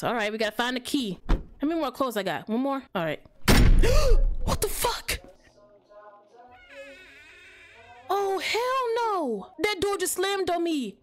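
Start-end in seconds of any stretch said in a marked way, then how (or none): 3.4-4.4 sharp high gasp, very loud; loud startled gasp. Real, not acted. Door slams, loud gasp. 4.4-11.0 high, slow frightened disbelief; startled and scared. Real, not acted. Door slams behind him.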